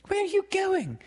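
put-on very high, anxious girl's cry; cry of misgiving. A narrator's reading. Wendy seeing Peter head for the window, anxious high cry. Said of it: "she cried with misgiving".

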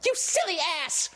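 very high, squeaky fairy voice; Tink's insult. A narrator's reading. Tink replying rudely to Peter, tiny high squeak. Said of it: "Again, Tink replied".

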